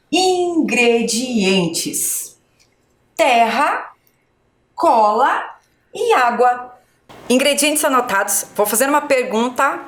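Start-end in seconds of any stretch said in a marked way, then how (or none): 0.1-2.4 "Ingredientes" stretched out syllable by syllable; slow read-along. Shown as a demonstration. Teacher leading pupils in reading, slow and drawn out. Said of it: "Vamos ler com a professora agora?". 3.2-6.8 measured list with pauses between items; slow read-along. Shown as a demonstration. Teacher reading the list with pupils, slow and measured. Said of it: "Vamos ler com a professora agora?".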